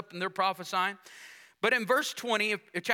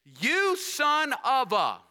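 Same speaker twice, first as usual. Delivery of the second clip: slow, loud, higher angry cry; angry outburst; 5 semitones higher, 4 dB louder, much slower than usual. Acted. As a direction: burst out in Saul's rage, dragging it out. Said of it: "Then Saul's anger burst against Jonathan".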